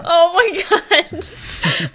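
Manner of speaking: high, drawn-out gasp of delight; laughing hard. Real, not acted. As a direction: squeal it out through real laughter. Cracking up at the impression.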